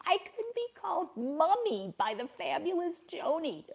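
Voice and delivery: giggly delight. Real, not acted. Giggling over being called Mummy.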